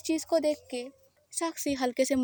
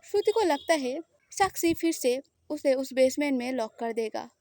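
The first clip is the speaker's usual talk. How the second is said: plain reading voice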